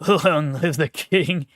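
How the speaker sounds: slow, loud, laughing royal cheer; laughing proclamation. Pretend. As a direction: hail the king through laughter.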